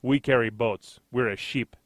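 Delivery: a weak stab at a Norwegian captain; mild mock Norwegian ship captain; bad Norwegian accent. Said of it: "I didn't do that accent very well".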